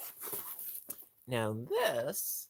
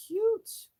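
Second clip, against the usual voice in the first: high, slow squeaky coo, louder; cutesy squeal; 9 dB louder than usual. Real, not acted. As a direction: gush over it in a tiny high voice. Cooing over toy.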